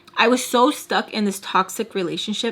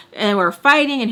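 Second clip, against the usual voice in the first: ordinary talk, nothing marked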